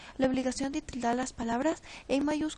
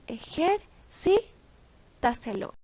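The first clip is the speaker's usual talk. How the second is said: ejercitaselo slowly split, CÍ punched; slow syllables, weight on CÍ in ejercitaselo; stress before antepenultimate; 4 dB louder, much slower than usual. Shown as a demonstration. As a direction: say ejercitaselo as e-jer-CÍ-ta-se-lo. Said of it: "la sílaba tónica es la ante-antepenúltima".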